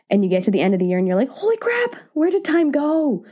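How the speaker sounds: ordinary talk, nothing marked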